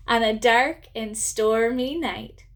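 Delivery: ordinary talk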